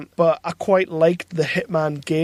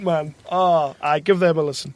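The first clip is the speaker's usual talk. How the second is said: ordinary talk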